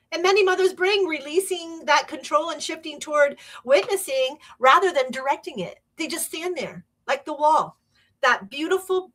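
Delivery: usual speaking voice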